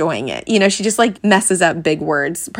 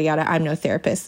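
ordinary talk

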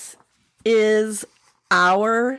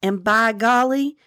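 lilting folktale narration; Southern story narration; 6 semitones higher than usual. Acted. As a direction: spin the tale for the listeners. Reads the story aloud.

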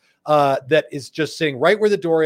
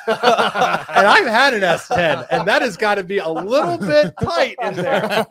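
laughing; 4 semitones higher, 6 dB louder, slower than usual. Real, not acted. Laughing about the old truck.

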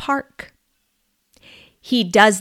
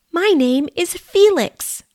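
higher little mouse voice; lightly voiced small mouse introducing itself; 9 semitones higher than usual. Mouse Felix introducing himself to Ed, higher voice. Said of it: "the mouse says".

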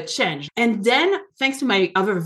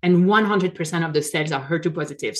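quick, eager recital of the good news; rushed hopeful quote; much faster than usual. Retold or imitated. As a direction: rattle it off, holding on to hope. Clinging to reassuring words.

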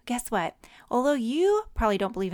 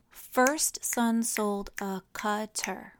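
"First son sold a cutter" in six slow, separate beats; "cutter" split as cut-ter, each word measured; syllable by syllable; much slower than usual. Shown as a demonstration. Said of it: "Let's count how many syllables".

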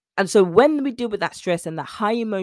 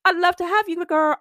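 higher and louder, gushing; excited invite; 11 semitones higher, 4 dB louder than usual. Shown as a demonstration. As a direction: sing it out, thrilled to have her. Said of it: "repeats the line twice".

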